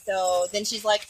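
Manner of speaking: usual speaking voice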